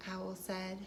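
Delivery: gentle storybook read-aloud; reading to children. A narrator's reading. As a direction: tell it like a bedtime story for little ones. Reading picture book aloud.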